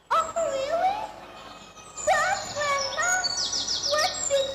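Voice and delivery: put-on high, bright child voice, slow on the question; wide-eyed little girl asking grandma.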